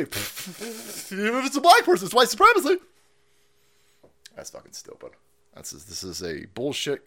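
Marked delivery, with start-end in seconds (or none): 0.0-2.8 mocking her voice. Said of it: "she told me".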